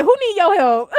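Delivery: high, hammed-up scoffing question; playfully exaggerated sass; sassy mock dismissal. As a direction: brush them off in a high mock voice. Acts out heroine's dismissive attitude.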